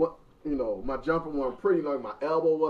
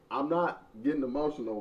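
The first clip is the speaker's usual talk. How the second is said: ordinary talk, nothing marked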